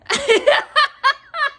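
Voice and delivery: loud, high burst of laughter. Real, not acted. Storyteller cracks up at her own comeback, amused. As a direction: cackle at your own comeback.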